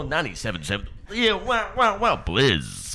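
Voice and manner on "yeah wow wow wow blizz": silly excited. Pretend. Silly high excited outburst.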